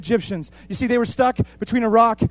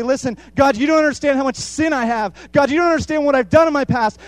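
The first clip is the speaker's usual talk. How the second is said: pleading outcry; 7 semitones higher, 7 dB louder than usual. Retold or imitated. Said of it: "we'll say".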